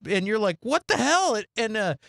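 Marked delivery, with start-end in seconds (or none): 0.6-1.3 mock shocked. Viewer's shocked reaction.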